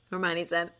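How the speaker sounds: ordinary delivery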